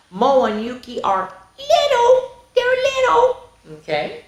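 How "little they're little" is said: very high, squeaky little voice; tiny voice. Acted. Teacher reading opposites, squeaking the little ones. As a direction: make it sound tiny.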